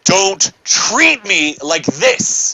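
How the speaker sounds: slow, loud, drawn out warning; forceful warning. Retold or imitated. Finger in her face, slow angry warning. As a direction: stretch every word as a threat. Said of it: "And I said".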